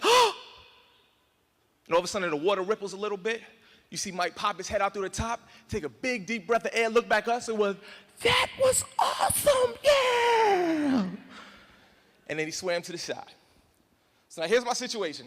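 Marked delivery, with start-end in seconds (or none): 0.0-0.3 short high yelp of alarm; shocked scream. Retold or imitated. Friends watching the splash, high scared yelp. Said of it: "We're like". 8.2-11.1 very high, slow, stretched cheer; thrilled friend. Retold or imitated. Friend surfacing from the river, high elated cheer.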